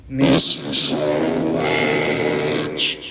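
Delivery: low, slow warped voice; voice effect. Shown as a demonstration. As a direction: sing it through the voice changer. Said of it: "miss din namin kayo".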